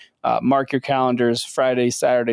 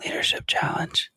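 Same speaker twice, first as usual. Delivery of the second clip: breathy stage whisper announcing the segment; 7 dB quieter than usual. Acted. Co-host whispering the segment title as a joke. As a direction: hush it like a secret reveal. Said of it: "nice role reversal".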